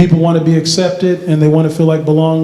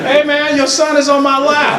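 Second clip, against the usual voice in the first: higher-pitched uneasy teenager imitation; complaining senior; 7 semitones higher than usual. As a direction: complain as the big kid with a stranger's child on him. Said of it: "Senior, he was like".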